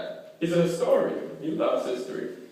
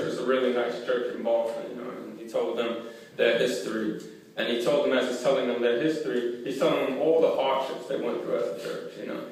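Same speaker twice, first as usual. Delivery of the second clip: ordinary talk, nothing marked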